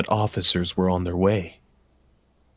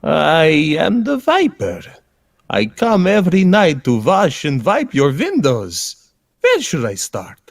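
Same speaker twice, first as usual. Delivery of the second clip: put-on high, bright silly accent reveal; spring the punchline in a goofy high voice; cheerful window washer; 8 semitones higher than usual. Storyteller reveals the Viper as a jolly window cleaner.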